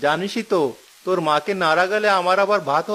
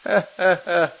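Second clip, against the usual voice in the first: teasing chuckle. Acted. Husband laughs at wife's anger.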